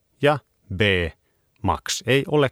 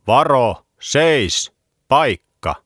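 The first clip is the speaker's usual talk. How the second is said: loud grandpa's warning shout; 6 semitones higher, 6 dB louder, slower than usual. A narrator's reading. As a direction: call out the commands firmly. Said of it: "Vaari huusi".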